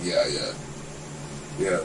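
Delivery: ordinary talk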